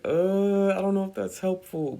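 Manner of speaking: high, doubtful aside; thinking this advice is useless; mock skeptical thought; skeptical inner voice. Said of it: "And I was like".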